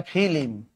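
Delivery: ফিলিম with an i slipped between l and m; rustic fi-lim for ফিলিম; split cluster. Shown as a demonstration. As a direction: say ফিলিম in two syllables, fi-lim. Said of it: "এ পাশে গেলে হয়".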